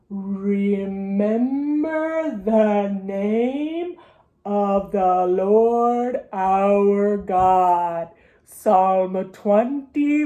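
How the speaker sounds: very slow, drawn-out verse recital; slow motion. Shown as a demonstration. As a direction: stretch every vowel like slow motion. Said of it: "say it kinda like your slow motion".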